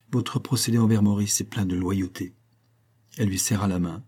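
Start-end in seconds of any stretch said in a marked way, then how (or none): none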